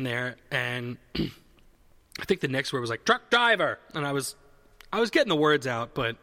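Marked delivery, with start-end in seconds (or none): 3.1-3.8 high, loud angry outburst; aggressive shout. Retold or imitated. Patient mimicking the next test word, aggressive shout.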